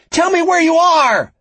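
very high, full-force shouted demand; shouting. A narrator's reading. Frankie demands the vanished captor's location, shouting. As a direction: shout desperately at someone who has gone. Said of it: "Frankie shouted".

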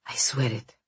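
breathless whispered vow. Acted. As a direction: swear it in a hushed whisper. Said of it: "whispered Konstantin".

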